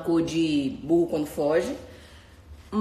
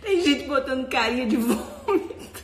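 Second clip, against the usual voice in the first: laughing; 6 semitones higher than usual. Real, not acted. Laughing at viewers' comments.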